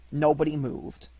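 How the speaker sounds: ordinary delivery, nothing marked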